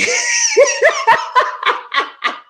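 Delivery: high, loud shrieking laugh. Real, not acted. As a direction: crack up at her own mistake. She laughs at her slip.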